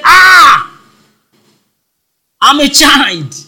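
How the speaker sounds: high, loud cry of protest; Yoruba shout. Acted. Jeremiah protesting his call to God, Yoruba shout. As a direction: wail it out in hammed-up dismay. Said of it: "the Yoruba will shout".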